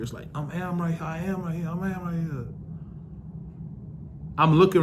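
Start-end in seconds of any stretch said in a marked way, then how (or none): 0.3-2.5 soft, distant echo in the head; echoing thoughts. Retold or imitated. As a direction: let it ring back faintly like an echo. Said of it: "I can hear my thoughts echoing".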